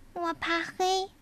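high, small scared cub voice; little bear cub voice. Acted. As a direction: sound like a frightened little cub. (小熊胆怯的童声)

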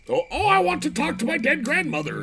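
high, hammed-up pleading voice; mock pleading customer. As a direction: squeak it out like a desperate widow. Mocking a customer seeking ghosts.

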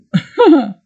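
high breathy laugh at her own slip; embarrassed laugh. Real, not acted. Stumbling over a streaming service name, a high embarrassed laugh.

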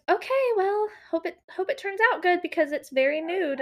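high, hesitant mock scepticism; imitated doubtful tech. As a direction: voice a nail tech with reservations. Retelling the tech doubting her colour.

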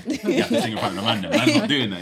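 loud laughing mock quote. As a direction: laugh through the mocking line. Mocking voice with laughter.

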